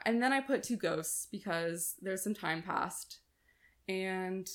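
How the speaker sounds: ordinary talk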